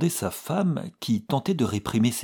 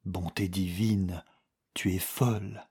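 ordinary delivery

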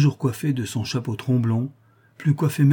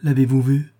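louder, startled question; still frightened; 3 semitones higher, 6 dB louder than usual. A narrator's reading. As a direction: ask it half scared. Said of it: "d'un ton encore effarouché". (ton encore effarouché)

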